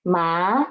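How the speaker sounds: rising tone demo; second tone ma. Shown as a demonstration. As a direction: lift ma upward. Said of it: "then the four tone is".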